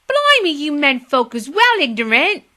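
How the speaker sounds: loud, high indignant scolding. Acted. Girl scolding the drunk menfolk, loud indignant. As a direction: tell the menfolk off, outraged. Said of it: "said Polly indignantly".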